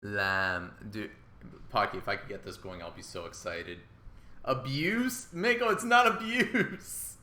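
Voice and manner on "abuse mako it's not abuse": high, loud, drawn-out protest; indignant outburst. Real, not acted. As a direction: cry out in disbelief at the accusation. Indignantly denying a chat accusation.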